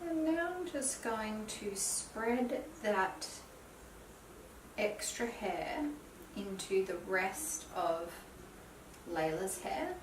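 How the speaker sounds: hushed, half-whispered tutorial voice; soft-spoken. Real, not acted. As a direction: talk softly while you work. Soft talk while styling hair.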